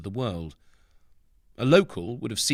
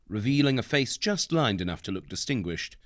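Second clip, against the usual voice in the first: usual speaking voice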